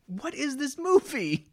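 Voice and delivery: high, loud, slow cry of disbelief; exasperated shout. Real, not acted. Reviewer fed up with the ridiculous movie, exasperated shout. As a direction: holler it in utter bafflement.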